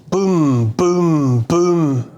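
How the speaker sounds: three drawn-out, falling booms; imitated slow booms. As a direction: intone each boom slowly, like distant cannon fire. Imitates slow-motion bombardment in commentary.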